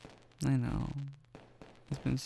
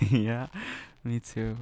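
ordinary talk, nothing marked

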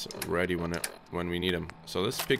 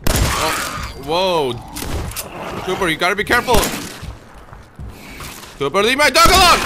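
much higher, louder frantic shouting; frantic yelling; 10 semitones higher, 9 dB louder than usual. Real, not acted. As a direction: shout in a frightened panic at the dog. Fighting rats, yelling at dog.

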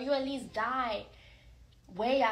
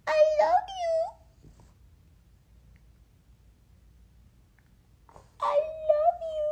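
put-on high baby-talk voice; 13 semitones higher than usual. Shown as a demonstration. Prompting Stitch to say it back, squeaky high coo. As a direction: coo it very high to get a bark. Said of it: "And then I could be like".

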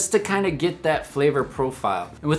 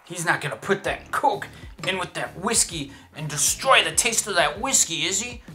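higher mock-horrified onlooker; horrified purist; 5 semitones higher than usual. Purist watches Coke threaten the whiskey, mock horror. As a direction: put on a scandalized higher voice.

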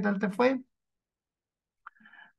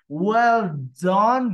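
ordinary talk, nothing marked

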